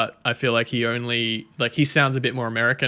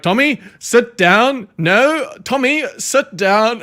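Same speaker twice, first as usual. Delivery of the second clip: put-on high, loud scolding in a South African accent; frustrated South African accent, raised and loud; South African teacher; 10 semitones higher, 7 dB louder, slower than usual. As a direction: mimic an exasperated South African teacher, drawing words out. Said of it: "the South African teacher being like".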